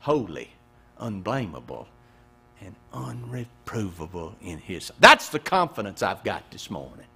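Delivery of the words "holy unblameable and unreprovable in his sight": hushed scripture reading. Acted.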